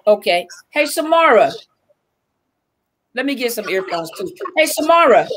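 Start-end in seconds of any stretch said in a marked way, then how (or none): 0.7-1.5 calling out. Real, not acted. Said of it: "I'm just hollering in the camera". 4.6-5.3 calling out. Real, not acted. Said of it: "I'm just hollering in the camera".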